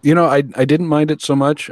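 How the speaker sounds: normal talking voice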